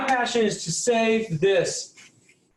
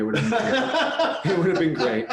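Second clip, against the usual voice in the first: laughing. Real, not acted.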